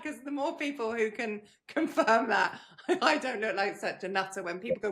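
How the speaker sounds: words broken by real laughter; laughing while talking. Real, not acted. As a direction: giggle through the line. Laughing at her own situation.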